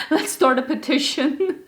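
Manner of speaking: laughing through the words. Real, not acted. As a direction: laugh it out at yourself. Laughing at own French.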